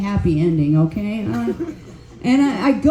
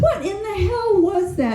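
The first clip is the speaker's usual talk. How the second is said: incredulous; 10 semitones higher than usual. Retold or imitated. Retelling disbelief at massage.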